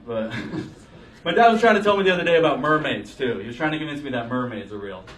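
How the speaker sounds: ordinary delivery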